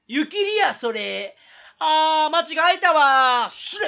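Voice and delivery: 関西弁風に高く大声でツッコむ; 間違いに大げさに叫ぶ; 一人ツッコミの絶叫. Acted. (声を張り上げたボケツッコミ)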